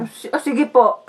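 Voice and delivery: slow, hesitant sickly agreement; put-on poorly stammer; feigning sickness. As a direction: agree feebly as if ill. Faker agrees, feigning illness.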